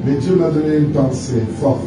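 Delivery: ordinary talk, nothing marked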